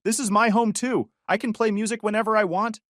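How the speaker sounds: high shrill yell; screaming. Retold or imitated. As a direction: yell it through a closed door. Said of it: "she screamed through the door".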